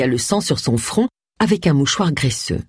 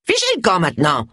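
put-on cross old woman ordering someone out; old lady crying out. A narrator's reading. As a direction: send them packing in an old lady's voice. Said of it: "s'écria la vieille dame". (vieille dame excédée qui s'écrie)

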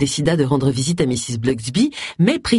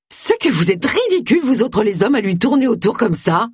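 high-pitched spiteful retort; acted, aggressive comeback in a raised pitch; snappish; 6 semitones higher than usual. A narrator's reading. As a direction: fire back with open scorn. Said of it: "répliqua Joyce avec hargne".

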